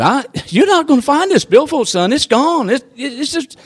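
high-voiced retold scoffing; scoffing naysayer.

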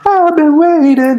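sung high and slow, way up in falsetto; high falsetto wail. Retold or imitated. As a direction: reach for the top note in falsetto.

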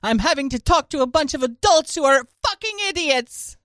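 imitating Greta Thunberg. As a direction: scold the adults like an indignant teen. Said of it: "Greta, "How dare you?".

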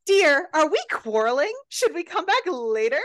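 high, exaggerated innocent questioning; hammed-up faux-polite worry; mock concern. [name] feigns concern at interrupted quarrel.

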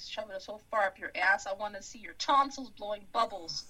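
ordinary talk, nothing marked

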